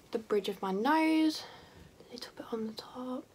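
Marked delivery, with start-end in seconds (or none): 2.1-3.2 breathy whisper to herself mid-application. Real, not acted. Vlogger whispering to herself as she highlights her nose. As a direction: murmur it under your breath while concentrating.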